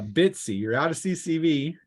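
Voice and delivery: ordinary talk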